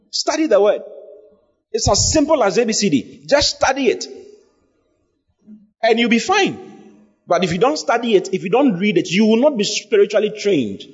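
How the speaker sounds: high-pitched, insistent sermon delivery; exhorting preacher. Real, not acted. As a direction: urge the congregation with rising fervour. Exhorts the congregation.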